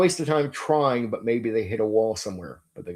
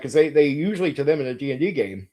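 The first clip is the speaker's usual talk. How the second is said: ordinary talk, nothing marked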